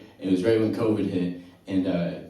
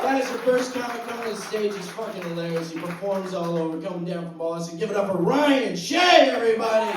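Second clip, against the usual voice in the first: high-pitched stage announcer; raised MC voice building to the name; MC introduction; 8 semitones higher than usual. Real, not acted. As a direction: announce the next act, stretching out the name. MC introduces the next comic.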